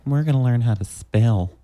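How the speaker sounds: put-on teacher in a New Hampshire accent. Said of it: "It was always like".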